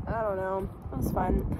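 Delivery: ordinary delivery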